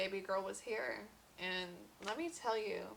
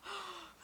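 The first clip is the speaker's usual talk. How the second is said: relieved gasp; 11 semitones higher, 7 dB quieter than usual. Retold or imitated. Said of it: "I was like".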